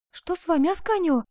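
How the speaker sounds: high-pitched alarm; frightened question. A narrator's reading. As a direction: ask in sudden fright. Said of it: "испуганно спросила Коломба". (испуганно, высоким голосом)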